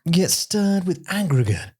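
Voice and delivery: high, mock posh narrator voice; lightly imitating a storyteller droning in the ear; Stephen Fry narrating. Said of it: "Stephen Fry's in your ear going".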